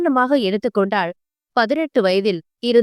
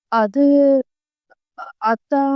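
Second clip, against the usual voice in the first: stammering, lost for words; hesitant broken start; faltering; slower than usual. Acted. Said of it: "பதில் சொல்ல முடியாமல் தடுமாறினாள்".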